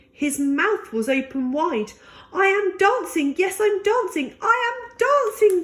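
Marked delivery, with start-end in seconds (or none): 2.3-5.6 high, rising excitement; exultant, each repeat pitched higher; joyful cry. A narrator's reading. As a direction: cry it out in growing delight. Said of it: "Gerald cried".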